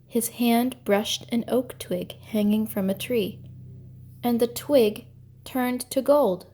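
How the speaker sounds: usual speaking voice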